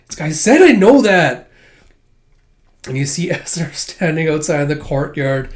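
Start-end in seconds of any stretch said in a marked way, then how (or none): 0.3-1.5 louder, clipped annoyance; irritated retort. Retold or imitated. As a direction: snap back impatiently. Becky annoyed at repeat.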